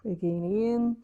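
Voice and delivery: calm, paced breathing instructions; guided breathwork. Acted. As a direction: coach the breath step by step, unhurried. Leads a breathing exercise.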